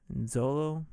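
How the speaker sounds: normal talking voice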